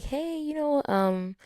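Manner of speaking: high, light mock-friendly asking voice; high voiced fan asking about a birthday gift. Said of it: "some people were reaching out like".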